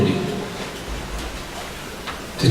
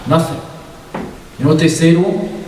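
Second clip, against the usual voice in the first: usual speaking voice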